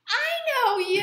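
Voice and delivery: high, loud delighted cry; a clerk's loud joyful recognition; half-laughing, very high "I know you!"; excited shout. Retold or imitated. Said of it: "She goes".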